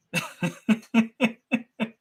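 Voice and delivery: high burst of sheepish laughter; embarrassed laugh. Real, not acted. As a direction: laugh it off, caught out. Caught after insulting the buyer.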